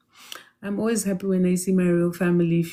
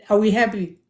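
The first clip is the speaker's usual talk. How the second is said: ordinary delivery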